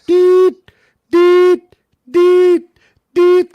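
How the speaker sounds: loud, very high electronic deet deet; alarm beep imitation. Hotel fire alarm panel beeping, loud high imitation. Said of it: "It's just beeping, going".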